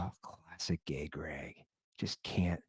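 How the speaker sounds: very soft, low grumble to oneself; muttered aside. Retold or imitated. Man grumbling privately about his nosy neighbor, muttered. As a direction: mutter it under your breath, barely voiced.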